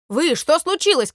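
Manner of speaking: loud questioning call. Acted. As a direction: shout over to a noisy crowd. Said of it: "крикнул трубочист".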